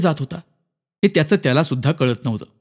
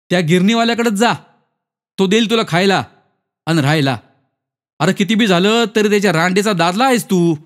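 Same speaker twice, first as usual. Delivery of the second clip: higher-pitched, taunting advice; mocking character voice, pitched up; jeering acquaintance; 5 semitones higher than usual. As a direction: send them off with a sneer.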